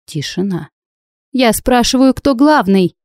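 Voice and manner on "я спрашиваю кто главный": higher-pitched insistent demand; shouting. A narrator's reading. Heroine demanding the person in charge from judges, shouting. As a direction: raise the pitch and demand an answer. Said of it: "вновь закричала я".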